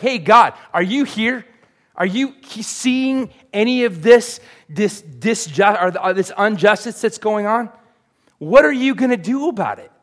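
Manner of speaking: high, loud indignant cry to God; Habakkuk's complaint. Acted. Preacher voicing Habakkuk crying out to God, loud and high. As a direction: demand answers from God at full voice. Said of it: "Habakkuk has a very interesting way to communicate with God".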